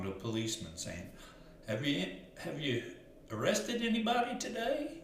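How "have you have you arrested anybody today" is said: high, eager mock busboy question. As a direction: squeak it like a naive busboy asking a cop. Busboy quoted asking a policeman.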